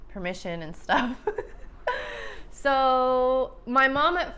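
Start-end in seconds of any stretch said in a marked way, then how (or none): 1.2-2.5 high, soft crying giggle; tearful laugh. Real, not acted. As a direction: break into a small laugh through tears. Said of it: "why am I crying".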